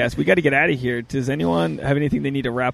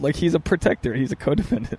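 normal talking voice

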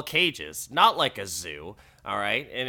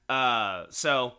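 usual speaking voice

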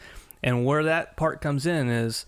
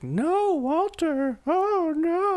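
high falsetto pleading wife; mock wife; much slower than usual. Podcaster mimics Walter's wife begging him, in falsetto. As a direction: squeal it like a frantic wife. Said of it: "his wife is like".

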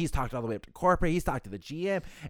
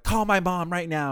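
higher, louder, drawn-out demand; mock scream; 7 semitones higher, 5 dB louder, slower than usual. Hotel guest screaming in the background for his mom, mock scream. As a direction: imitate a guest yelling from the background. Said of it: "he's, like, screaming something in the background".

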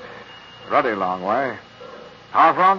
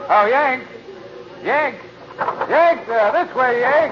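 calling out; 11 semitones higher, much slower than usual. Acted. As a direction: shout to catch a man's attention outside. Calling to American on platform.

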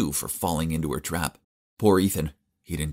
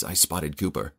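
ordinary delivery

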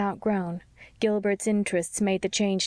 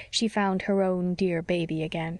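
ordinary delivery

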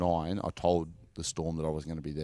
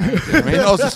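laughing; 15 dB louder, faster than usual. Real, not acted. Laughing at coach's tricks.

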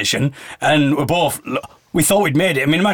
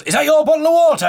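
high, louder eager stranger; high-pitched helpful man; 12 semitones higher, 4 dB louder, faster than usual. Retold or imitated. Retold helper asking about bottle.

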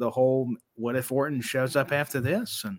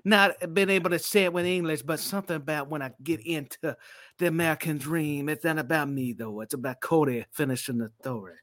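cutting a promo as Dusty Rhodes; Dusty Rhodes impression. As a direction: put on the American dream's voice. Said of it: "Mate, that's fucking brilliant".

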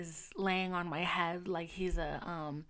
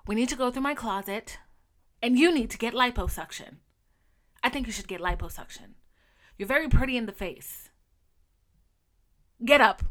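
loud, bossy, higher impression; imitating Wendy Williams; 3 semitones higher, 11 dB louder than usual. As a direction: boss the assistant around loudly. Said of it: "she's talking to her assistant".

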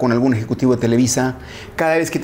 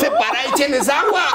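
laughing joke; 10 semitones higher than usual. Acted. Joking about separating dogs.

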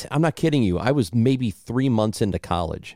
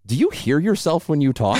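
higher-pitched mocking question; pointed, condescending mock concern; 5 semitones higher than usual. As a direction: imitate someone talking down to a friend. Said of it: "it's a very condescending question".

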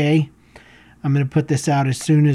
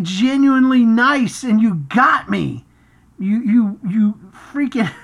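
high, loud, laughing disbelief; hammed-up betrayed shock, half laughing; mock outrage; 9 semitones higher, 4 dB louder than usual. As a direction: act amazed that she tricked you. Caught out by the saleswoman.

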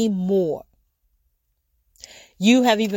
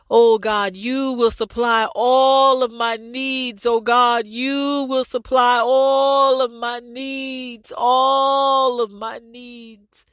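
shouted prayer. Real, not acted. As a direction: cry it out to God with full force. Prayer building to full cry.

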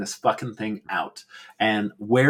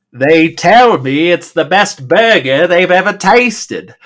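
higher, much louder, slow proud boast; imitating the proprietor; 6 semitones higher, 10 dB louder, slower than usual. Podcaster mimicking the burger owner's boast, loud and slow. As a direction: puff up like a bragging restaurant owner. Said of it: "the way he talks is".

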